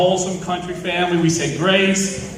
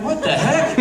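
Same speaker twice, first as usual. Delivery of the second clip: laughing; 7 semitones higher than usual. Retold or imitated. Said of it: "I'm like".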